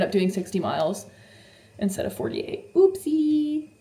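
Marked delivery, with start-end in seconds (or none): 2.7-3.7 high, drawn-out singsong oopsie; playful oopsie. Real, not acted. Vlogger confessing she rode too far, playful singsong. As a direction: sing out the slip, high and slow. Owning up to a mileage overshoot.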